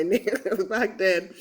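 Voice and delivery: usual speaking voice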